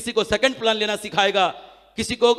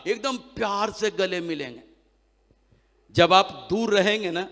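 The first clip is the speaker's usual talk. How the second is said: usual speaking voice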